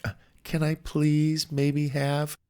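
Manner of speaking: timid first ask. Retold or imitated. A nervous caller asking the company for help, halting and timid. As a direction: ask shyly, barely daring.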